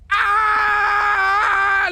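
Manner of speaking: long, loud high-pitched scream. Acted. Witness re-enacts his scream as he fired, long loud scream. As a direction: scream at full pitch while firing a gun. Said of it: "screamed as I fired".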